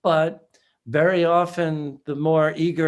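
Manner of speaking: normal talking voice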